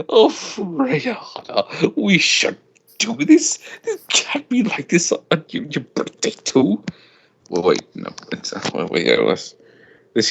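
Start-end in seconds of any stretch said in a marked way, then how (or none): 0.1-6.8 higher, slower, begging sister's voice; pleading girl voice. Acted. Reading the sister's plea in a high put-on voice. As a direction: plead sweetly, much higher.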